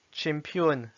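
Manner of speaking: "Champion" as Russian "chempion"; Russian pronunciation. Shown as a demonstration. Teacher reading words with learners, "Champion" in Russian. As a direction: read "Champion" in Russian, starting "tch". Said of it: "s'entraîner à lire les mots".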